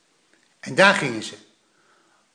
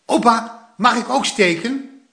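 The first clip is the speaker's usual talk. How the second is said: put-on eager boy asking; 8 semitones higher than usual. A narrator's reading. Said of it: "En Plop zei".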